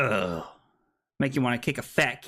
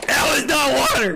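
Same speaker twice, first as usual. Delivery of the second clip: high, outraged playful shout; shouted protest; 9 semitones higher, 4 dB louder than usual. Real, not acted. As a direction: cry foul at the top of your range. Protesting the drink trick.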